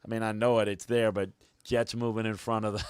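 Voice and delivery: ordinary delivery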